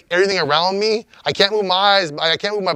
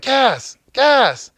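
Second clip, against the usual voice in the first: imitated frantic calling, much higher pitched; freaking out; 11 semitones higher, much slower than usual. Friend calling to him after the shock, imitated freaking out. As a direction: mimic a friend urgently calling a name, climbing high. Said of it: "He's, like, freaking out".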